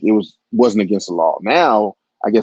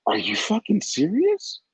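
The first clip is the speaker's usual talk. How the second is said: inner fury; 12 semitones higher, 5 dB quieter than usual. Retold or imitated. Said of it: "up here, I'm going".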